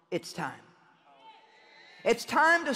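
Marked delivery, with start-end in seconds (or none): 0.1-0.6 low, hushed, gentle certainty; sweet but strong. Retold or imitated. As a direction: drop low and soft, gently firm. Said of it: "so sweetly but so strongly".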